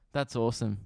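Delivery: ordinary talk, nothing marked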